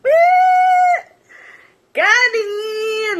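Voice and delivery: very high, loud, drawn-out; shocked shriek. Real, not acted. Reacting to the comeback, shocked shriek. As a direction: scream it in shock.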